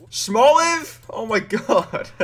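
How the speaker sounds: high squeal of joy; excited shriek. Real, not acted. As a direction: shriek it out in delight. Shiny found, squeal and laugh.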